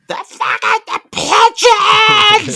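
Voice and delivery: high-pitched, drawn-out mock scream at full volume; imitated screaming. Podcast host mimicking kids screaming as they charge at pigeons, screaming. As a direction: scream it at the top of your lungs, stretching the words. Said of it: "they're screaming".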